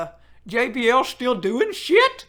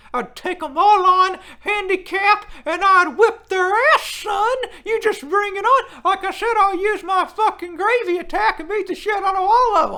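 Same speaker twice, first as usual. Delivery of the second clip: mock trash talk; 5 semitones higher, 5 dB louder than usual. Boasting he'd beat the crew.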